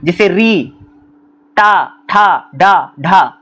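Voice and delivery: टा, ठा, डा, ढा said with the tongue curled; teacher sounding out letters; retroflex sounds demo. Shown as a demonstration. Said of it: "इनको बोलते हैं".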